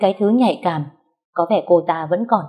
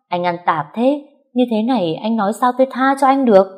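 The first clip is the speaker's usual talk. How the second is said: furious scolding; 4 semitones higher than usual. Acted. Wife berating her husband caught cheating, furious. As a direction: tear into him, pitch climbing.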